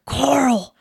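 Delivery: loud mock Rick Grimes shout; mock yell for Carl. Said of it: "Rick Grimes".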